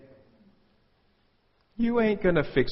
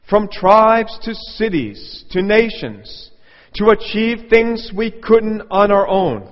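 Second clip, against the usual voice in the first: higher, much louder orator's voice; mock grand speech; 9 semitones higher, 11 dB louder, slower than usual. Preacher mocking a speech about history, loud pompous declaiming.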